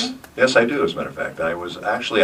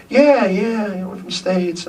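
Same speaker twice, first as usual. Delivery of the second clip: high-pitched Southern woman's voice; put-on Southern US accent; imitated southern accent; 14 semitones higher than usual. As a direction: mimic a Southern girl, pitched well up. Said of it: "she had this southern accent".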